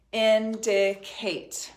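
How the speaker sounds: "Indicate" slowly in three separate, even beats; syllable by syllable. Shown as a demonstration. Teacher counting syllables with the class, syllable by syllable. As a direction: pound out "Indicate" with a gap after each syllable. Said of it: "sound it as you're writing it".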